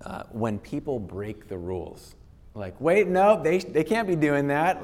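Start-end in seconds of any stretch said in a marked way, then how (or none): 2.8-4.8 higher, mock-indignant protest at a rule breaker; indignant outrage. Speaker imitating someone upset at a rule breaker, in mock indignation. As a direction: act out the shock of someone cutting in, pitched up.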